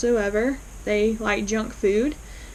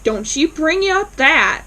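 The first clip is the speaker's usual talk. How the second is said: upset; 5 semitones higher, 5 dB louder than usual. Retold or imitated. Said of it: "he gets upset and he's like".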